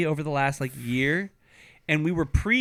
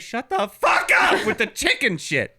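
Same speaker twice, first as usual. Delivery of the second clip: mock angry shout; 6 semitones higher, 5 dB louder than usual. Telling off a partner for saying chicken, mock shout. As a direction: yell it at him like you've had enough. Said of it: "I would just be like".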